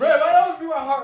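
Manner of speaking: yelled high through laughter; loud laughing protest. Real, not acted. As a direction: half-shout it, cracking up. Protesting friends laughing at him.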